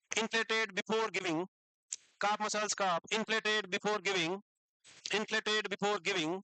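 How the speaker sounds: very slow, word by word; slow dictation. Shown as a demonstration. Teacher dictating notes to students, very slow. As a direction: dictate so they can write along. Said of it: "अभी मैं रुक रुक के बोलूंगा".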